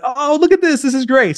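high-pitched, delighted dad; light up as you spot the family fun; acted delight. Dad delighted joining pizza making.

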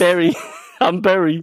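"Berry" for very, with a B; "Berry" in a teasing Filipino accent; mock Filipino accent. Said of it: "I'm from the Philippines".

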